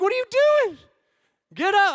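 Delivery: high, loud dad outburst; loud parental disbelief; exasperated yell. Retold or imitated. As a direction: yell at a kid to get up. Dad confronts limp child.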